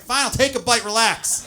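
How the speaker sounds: loud, high, fed-up surrender; exasperated giving in. Acted. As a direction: give in to a pushy host, mock exasperated. Giving in after repeated pie nagging.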